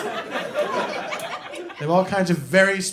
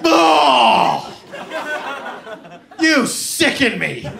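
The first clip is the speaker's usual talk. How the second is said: disgusted dentist; 5 semitones higher, 3 dB louder than usual. Retold or imitated.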